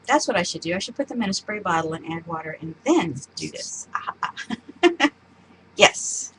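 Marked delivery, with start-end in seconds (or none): 3.9-5.1 laughing. Real, not acted. Laughs at own plan.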